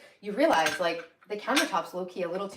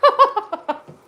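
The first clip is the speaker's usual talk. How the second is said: high loud burst of laughter; 13 semitones higher, 10 dB louder than usual. Real, not acted. Laugh at own joke.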